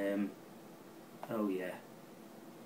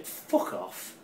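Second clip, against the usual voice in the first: outraged swear; 7 semitones higher than usual. Real, not acted. Outraged at City's bid.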